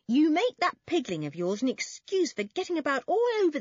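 high, loud telling-off; scolding older brother. Acted. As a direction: lay into the little brother. Toby scolds Benny.

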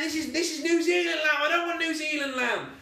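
high, put-on posh lady whining about lamb; imitating a snobbish Waitrose customer, pitched well up; mock posh customer. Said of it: "So she's like saying".

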